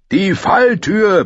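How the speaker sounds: high, slow drawn-out bellow. A narrator's reading. Fighter pointing Arya to escape, bellowing. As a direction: roar it across a battle. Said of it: "brüllte er".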